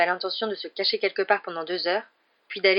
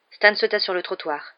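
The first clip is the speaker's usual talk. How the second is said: ordinary delivery, nothing marked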